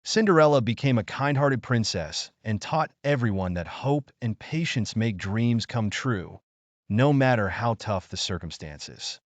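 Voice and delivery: ordinary delivery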